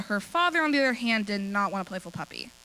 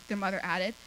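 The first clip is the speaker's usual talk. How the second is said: plain reading voice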